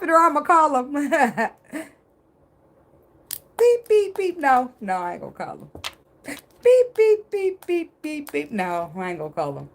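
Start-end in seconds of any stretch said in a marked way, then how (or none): 3.6-4.4 mock phone beeps. Mock phone call to police. 6.6-8.5 mock phone beeps. Mock phone call to police.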